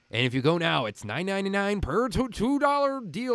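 mock commercial announcer, rising pitch. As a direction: parody a pushy price announcement, stretching the end. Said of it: "being able to do a commercial voice".

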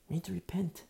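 whisper. Real, not acted. Pastor urging congregation to repent.

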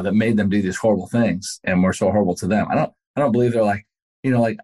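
ordinary delivery

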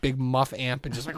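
normal talking voice

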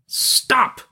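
high mock shout, held back in volume. Prince over the boys' din, contained high shout. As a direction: call it over a din, pitched right up. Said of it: "shouting to make himself heard above the din".

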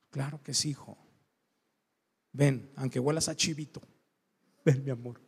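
low, soft, affectionate father; tender forgiveness. Retold or imitated. Father forgiving his son and calling him over, tenderly. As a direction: gently take the child back in.

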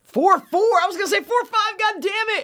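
high, loud cry of frustration; real yelp of exasperation, pitch way up; exasperated outburst. Real, not acted. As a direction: burst out, kicking yourself over a near miss. Guess was just off, exclaims.

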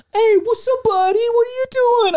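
put-on very high, loud baby-talk to a pet; dog-talk voice. Host greeting his dog after the video, high dog voice. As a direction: squeal it sweetly at the dog. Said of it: "go into dog voice".

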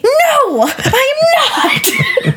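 high-pitched, laughing protest; flustered squeal. Retold or imitated. Answering roommate while hiding in bathroom, flustered squeal.

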